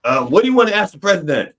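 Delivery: high, loud mock first lady. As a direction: bustle back in all business. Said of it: "she'd go".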